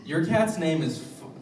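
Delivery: put-on furious kid shouting before a punch; enraged yell. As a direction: spit out "Your cat's name is fu-" as a threat. Angry six-year-old picking a fight.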